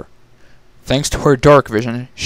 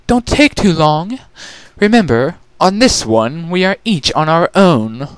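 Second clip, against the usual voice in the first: higher, smug little sister voice; smug younger sister; 6 semitones higher than usual. Acted. Chrysalis brushing off her sisters, smug and higher. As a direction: tease her sisters from above. Chrysalis shouting over shoulder.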